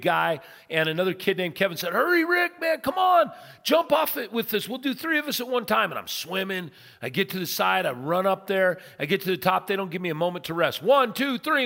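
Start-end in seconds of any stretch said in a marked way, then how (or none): 1.9-5.9 high, loud, hurried holler to a friend; calling out urgently. Retold or imitated. Buddy yelling at a tired swimmer to join the jump, urgent holler. 10.8-11.6 loud, high count before a jump; shouted countdown. Retold or imitated. Friends counting down before a cliff jump, shouted.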